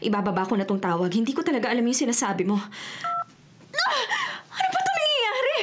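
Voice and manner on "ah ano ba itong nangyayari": high, startled cry of fear; a woman alone in her apartment, suddenly scared, crying out; frightened cry. Acted. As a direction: yelp in panic, then plead at the air.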